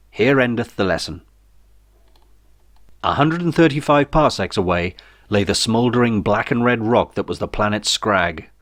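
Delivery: ordinary delivery, nothing marked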